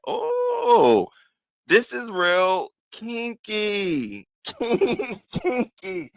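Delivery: hammed-up shocked delight with a giggle; mock scandalized. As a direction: savor it like a naughty secret. Trying on mesh socks.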